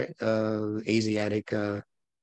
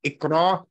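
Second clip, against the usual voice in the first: IQRA pitched high and loud, rising; punchy, high "Iqra!"; exclaimed IQRA; 7 semitones higher, 5 dB louder than usual. Acted. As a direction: call out "Iqra" as a raised exclamation. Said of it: "with an exclamation point at the end".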